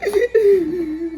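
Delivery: loud, high mock wail; exaggerated scared sobbing; imitated crying. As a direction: act out bawling from fear. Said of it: "I'm crying".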